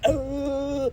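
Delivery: high, clipped haka cry; mock haka shout. New Zealand rugby players doing the haka, high mock cry. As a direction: imitate the haka call. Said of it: "going like".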